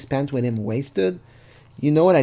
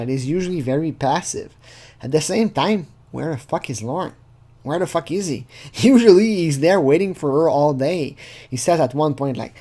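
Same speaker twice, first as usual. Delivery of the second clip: ordinary talk, nothing marked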